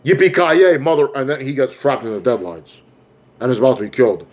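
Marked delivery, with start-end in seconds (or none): 0.0-1.1 raised, cocky action-hero yell; mock battle cry. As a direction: call it out high like a charging hero. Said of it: "comes out of nowhere and says".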